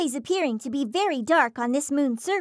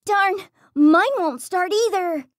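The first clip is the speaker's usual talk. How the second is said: fearful; 5 semitones higher, 4 dB louder than usual. Acted. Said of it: "Cronus feared".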